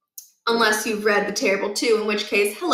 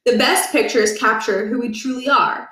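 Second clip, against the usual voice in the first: plain reading voice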